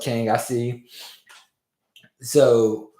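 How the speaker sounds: ordinary delivery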